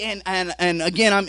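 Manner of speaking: usual speaking voice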